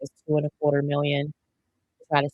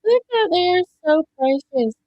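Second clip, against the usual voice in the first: high, louder cooing at the birds; 11 semitones higher, 5 dB louder than usual. Real, not acted. Streamer gushing to viewers over the nesting birds, cooing.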